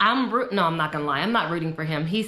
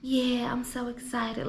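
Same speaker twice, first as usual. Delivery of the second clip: mocking flat talk; 5 semitones higher, 5 dB quieter, slower than usual. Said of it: "the way she talks".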